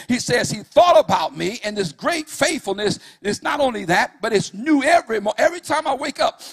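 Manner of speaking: whooping preacher at full force; full-force preaching. Acted. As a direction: preach it hard, riding the music. Builds preaching again.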